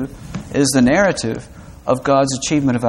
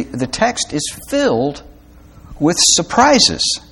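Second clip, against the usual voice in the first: surprises drawn out slowly and lifted much higher; stress on surprises; 8 semitones higher, slower than usual. Shown as a demonstration. To the congregation, surprises stretched slow and lifted high. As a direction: stretch out surprises to make it land. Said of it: "put the emphasis on the right syllable".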